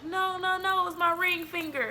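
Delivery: put-on a kid insisting it was the ring finger; high pleading protest. Retelling pleading with teacher.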